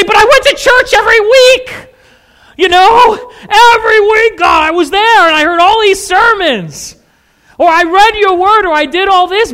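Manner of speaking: high, loud, crying plea; desperate pleading. Acted. Churchgoer crying out to God, high and loud with a desperate plea. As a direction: beg like someone shut out at the gate.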